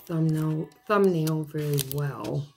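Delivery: ordinary talk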